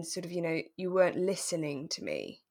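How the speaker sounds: normal talking voice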